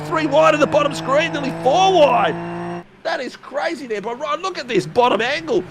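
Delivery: high-pitched, urgent crash commentary; excited race call. Real, not acted. Commentator calling cars going everywhere, excited race call. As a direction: call the pile-up as it unfolds, pitch way up.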